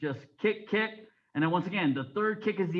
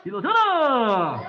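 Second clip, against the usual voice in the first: shouted drill commands; 2 semitones higher, 7 dB louder, much faster than usual. Real, not acted. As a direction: bellow each command, stretching the last vowel. Instructor calls out the turn.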